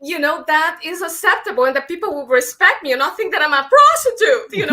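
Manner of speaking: laughing. Real, not acted. Laughing at her own joke.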